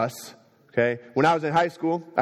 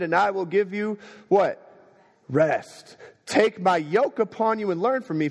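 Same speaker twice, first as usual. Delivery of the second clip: high, fervent reading of scripture; impassioned preaching; 8 semitones higher than usual. Acted. Pastor quoting Jesus to a weary congregation, fervent. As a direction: proclaim the verse to the whole room.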